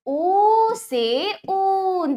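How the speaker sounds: "ऊ से ऊन" with "ऊ" drawn out very slowly; long oo. Shown as a demonstration. Teacher showing long "ऊ" to children. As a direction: hold the "ऊ" long in "ऊन". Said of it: "ये बड़ा ऊ है".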